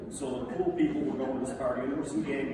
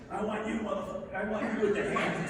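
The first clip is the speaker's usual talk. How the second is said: challenging thug; 8 semitones higher than usual. Retold or imitated. Big Rod voiced challenging Tommy.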